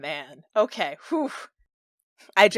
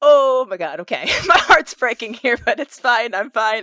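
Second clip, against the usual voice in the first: louder, heart-breaking half-laughing dismay; emotional; 4 semitones higher, 7 dB louder than usual. Real, not acted. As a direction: react overwhelmed, half laughing. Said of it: "I'm crying".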